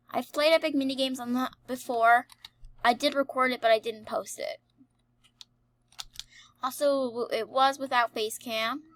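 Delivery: normal talking voice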